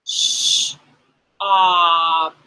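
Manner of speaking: "Sh-" hushed, then "op"; sound by sound. Shown as a demonstration. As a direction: push out "Sh-" and "op" separately. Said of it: "Okay, push it".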